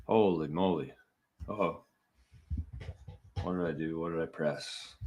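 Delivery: puzzled mutter, half to yourself; muttering to self. Real, not acted. Technical mishap at stream start.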